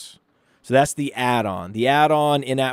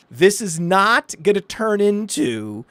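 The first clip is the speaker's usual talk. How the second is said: slow, emphatic scolding, pitched up; furious wife; 6 semitones higher, slower than usual. Retold or imitated. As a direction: lay down the law as an angry wife, imitated. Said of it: "And she was, like, furious".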